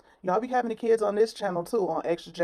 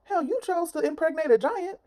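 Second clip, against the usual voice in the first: exasperated; 10 semitones higher than usual. Real, not acted. Exasperated high outburst.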